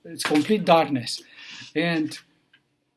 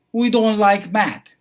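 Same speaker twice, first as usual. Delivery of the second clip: louder, higher mock threat from the gang boss; menacing boss; 5 semitones higher, 7 dB louder than usual. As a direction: lean in and lay the threat down hard. Gang boss threatens math teacher.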